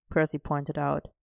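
usual speaking voice

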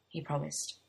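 ordinary delivery, nothing marked

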